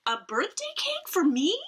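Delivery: high, delighted mother's cry; overjoyed, high and bright; excited cry. Acted. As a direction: squeal it with joy. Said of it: "she cried".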